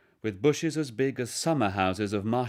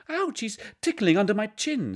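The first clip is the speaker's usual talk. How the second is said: put-on much higher ticklish little boy; high child voice, louder; giggly boy; 13 semitones higher, 5 dB louder than usual. A narrator's reading.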